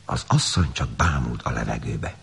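usual speaking voice

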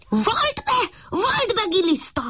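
high puppy voice yelling at the worm; little dog shouting at the worm. A narrator's reading. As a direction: demand a confession, shrill and insistent. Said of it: "kiabált a kiskutya".

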